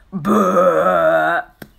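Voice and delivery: low, loud belch; loud burp. A narrator's reading. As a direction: let out a big burp. Baby burps.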